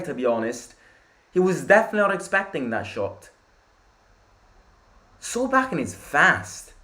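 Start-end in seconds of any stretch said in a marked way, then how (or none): 1.3-6.7 high-pitched, keyed-up reaction; amazed excitement. Real, not acted. Fan reacting after Solbakken's shot, amazed. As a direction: still buzzing from what just happened.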